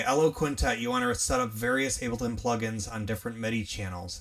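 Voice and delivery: normal talking voice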